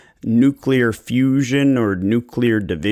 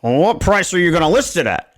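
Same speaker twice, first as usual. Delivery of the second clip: higher, put-on voice of a seller demanding a price; pushy seller voice; 6 semitones higher, 3 dB louder than usual. Seller pressing the agent on list price, higher put-on voice. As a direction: play the impatient homeowner cutting to the price. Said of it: "And they're like".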